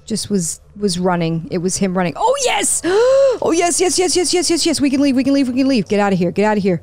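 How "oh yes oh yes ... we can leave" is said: high, gasping burst of joy; elated relief. Real, not acted. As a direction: squeal with relief, then rattle on excitedly. Finds way out, elated.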